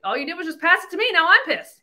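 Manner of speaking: mom annoyed. Retold or imitated. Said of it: "my mom's like".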